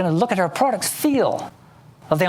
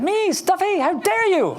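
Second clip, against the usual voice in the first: much higher, laughing mock outrage; mock indignation; 13 semitones higher than usual. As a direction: play at being offended, giggling. Mock outrage at nickname Stuffy.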